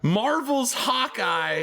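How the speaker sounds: high, slow, drawn-out proud cheer; real excited hype. Real, not acted. Host announcing a castmate's Marvel role, real excitement. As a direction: stretch the title out in triumph.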